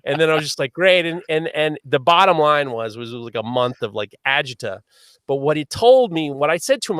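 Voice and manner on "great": relieved exclaim. Retold or imitated.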